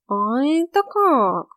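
high, slow lazy cat voice; snooty bored cat. Acted. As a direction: drawl it like a smug cat. Cat voice in the story. (высокий ленивый кошачий голос)

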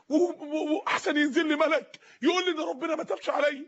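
ordinary talk, nothing marked

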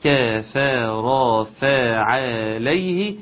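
كفر فعليه with every fatha fully voiced; six full fathas. Shown as a demonstration. Teacher showing the fathas, slow recitation. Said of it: "ست فتحات".

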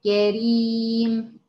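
كريم drawn out very slowly, the ra kept light; slow stretched كريم; tajweed demo word. Shown as a demonstration. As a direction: model كريم slowly with a thin ra. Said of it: "في وسط الكلمة زي كلمة".

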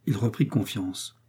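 ordinary delivery

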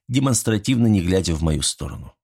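plain reading voice

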